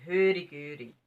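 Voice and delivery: plain reading voice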